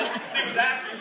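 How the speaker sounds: ordinary talk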